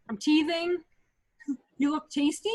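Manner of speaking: ordinary delivery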